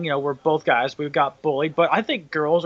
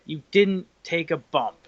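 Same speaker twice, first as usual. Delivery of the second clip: usual speaking voice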